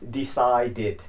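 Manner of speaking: "decided" slowly, ending in a clear "did". Shown as a demonstration. Teacher showing students the ending, "decided" as de-ci-did. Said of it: "note how I'm pronouncing the I D".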